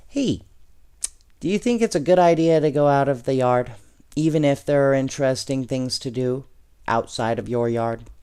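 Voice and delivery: usual speaking voice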